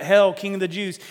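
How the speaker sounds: a loud jeering hail to a fake king; mocking shout. Preacher voicing the crowd shouting mockery at Jesus, loud jeer. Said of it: "They even shout out".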